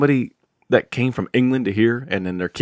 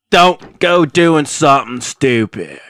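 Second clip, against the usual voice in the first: mock warning; 2 semitones higher, 7 dB louder, much slower than usual. Sign-off warning to viewers.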